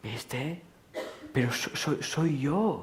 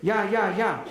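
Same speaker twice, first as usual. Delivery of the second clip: impatient concession; 7 semitones higher, 3 dB louder, much slower than usual. Acted. Acted reply in the argument.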